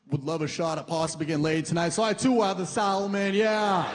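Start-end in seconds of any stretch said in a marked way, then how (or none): 1.9-3.9 high, slow swagger; ordering champagne and steak to impress his date, higher and showy; boastful big spender. Pretend. Showing off ordering pricey food.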